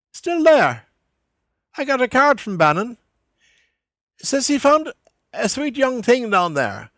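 Buck Mulligan's voice. A narrator's reading. Mulligan's dialogue voiced by narrator.

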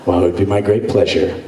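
low, quick, smug reply; Han Solo cool. Retold or imitated. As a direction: play it cool like a space smuggler. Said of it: "I was kinda Han Solo".

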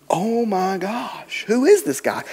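stunned, barely getting the words out; stunned awe. Retold or imitated.

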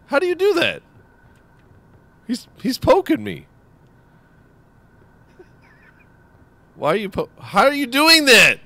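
high, loud incredulous squeal; amazed squeal. Real, not acted. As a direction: squeal in disbelief. Amazed at friend's trick.

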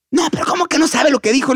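high, incredulous scolding; acted exasperation. Angry host refusing the puppeteer's excuse, high and exasperated. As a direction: mimic an exasperated boss. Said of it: "cuando se enojaba".

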